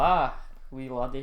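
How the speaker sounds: mock Scottish accent, hammed up. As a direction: put on a jokey Scottish accent. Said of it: "in a Scottish accent".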